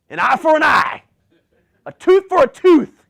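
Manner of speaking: put-on huge, high-pitched roar of the old law; booming shout. Shown as a demonstration. Preacher shouting the old law at the congregation. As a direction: bellow it like a vengeful crowd. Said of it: "you gotta say it like that".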